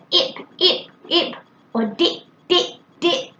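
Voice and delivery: short separate "ip, ip, ip" without the first d; dropped sounds. Shown as a demonstration. Imitating a child's unclear words, "ip" and "dip" missing sounds. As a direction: repeat "dip" with its end sound left weak. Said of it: "leaving off the first sound or the last sound".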